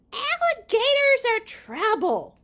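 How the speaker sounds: high, loud storybook warning; teacher's warning. Acted. Teacher warning a child about alligators, high loud alarm.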